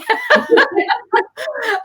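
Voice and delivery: high teary laugh breaking out; emotional laugh. Real, not acted. Moved host laughs it off.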